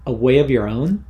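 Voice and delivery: usual speaking voice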